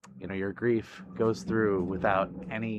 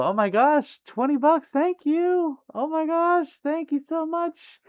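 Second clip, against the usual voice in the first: very high, loud, gushing gratitude; overwhelmed thanks; 6 dB louder, slower than usual. Real, not acted. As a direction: squeal your thanks, truly thrilled. Thanking viewer for surprise donation.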